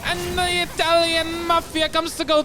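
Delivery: bad Italian accent, slow and loud; hammy mobster-style Italian accent. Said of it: "Did you like my Italian accent?".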